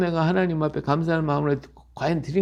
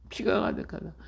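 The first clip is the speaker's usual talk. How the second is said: "피가 가득하다" whispered, much softer; whispered repeat; 3 semitones higher, 8 dB quieter than usual. Shown as a demonstration. Preacher echoing the verse to the congregation in a whisper. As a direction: breathe "피가 가득하다" as a hushed echo.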